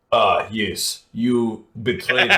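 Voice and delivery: mock Russian villain accent; hammy Russian villain. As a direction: ham up a Russian bad guy. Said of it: "the bad guys were like".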